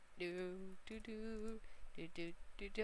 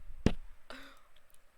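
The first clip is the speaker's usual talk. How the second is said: sharp gasp; 4 semitones higher, 5 dB louder than usual. Real, not acted. Flashback shock in the game.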